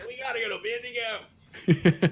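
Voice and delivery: loud, high, drawn-out cartoon holler; slow Australian cartoon parody shout; Aussie Rick and Morty. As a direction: stretch it out in a hammed-up Aussie voice. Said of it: "the Aussie Rick and Morty thing".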